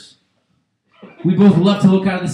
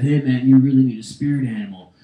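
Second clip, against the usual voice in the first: low, softer stoner-like advice; lightly voiced low, laid-back dealer; weed dealer; 7 semitones lower, 5 dB quieter than usual. Weed dealer advising his customer, lightly voiced low and soft. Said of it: "my weed dealer used to say to me".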